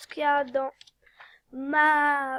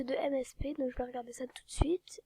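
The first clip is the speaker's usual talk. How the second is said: whisper; 7 dB quieter than usual. Real, not acted. Whispers channel name while playing.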